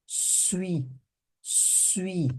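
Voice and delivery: suis said as one quick swee glide, softly; teacher demonstrating French suis. Shown as a demonstration. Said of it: "como se fosse falar suíço".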